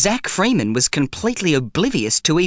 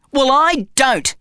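put-on stubborn boy; 10 semitones higher, slower than usual. A narrator's reading. Quarrel with bum.

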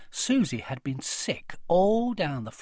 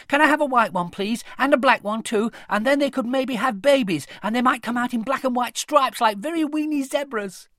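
put-on high, loud chattering child; eager little girl; 8 semitones higher, 6 dB louder than usual. A narrator's reading. Connie begging her parents for mice, high and loud. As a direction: gabble out the wish list like a small girl.

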